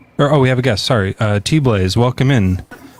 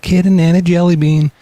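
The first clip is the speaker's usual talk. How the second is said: high, louder-than-chat cutesy squeal; cute anime voice; 8 semitones higher, 3 dB louder than usual. Acted. Cat owner mimicking an anime scene, high cute voice. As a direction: pitch it up like a cute cartoon. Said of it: "cute anime scene".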